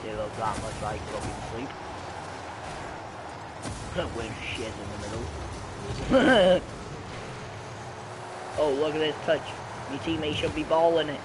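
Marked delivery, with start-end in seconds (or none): 1.7-4.1 wheezing laugh. Real, not acted. Laughs at own joke. 5.3-8.5 wheezing laugh. Real, not acted. Laughs at own joke.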